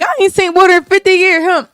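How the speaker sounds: thirsty man. Retold or imitated. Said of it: "This man like".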